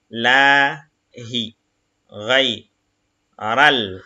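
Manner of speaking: each Arabic syllable said apart, slowly; syllable reading. Shown as a demonstration. Teacher reading Arabic syllables to the class, slow and separated. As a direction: spell the word out syllable by syllable. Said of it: "Donc, ça fait bil".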